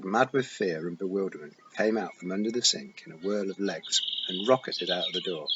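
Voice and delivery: plain reading voice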